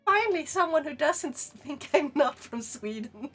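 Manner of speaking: ordinary talk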